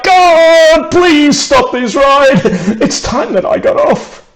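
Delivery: desperate cry. Acted. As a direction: beg the sky to make the ride stop. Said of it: "and Bill cried".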